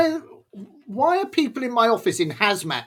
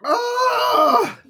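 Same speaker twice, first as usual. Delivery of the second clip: high pained crying sounds; 8 semitones higher, much slower than usual. Real, not acted. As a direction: sob from the burning. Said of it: "are you crying".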